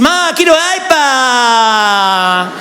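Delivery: voz aguda de niño caprichoso que se queja; nene malcriado. Retold or imitated. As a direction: imita a un nene consentido pidiendo el iPad. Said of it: "esos nenes que dicen". (voz de nene mimado, aguda y quejosa)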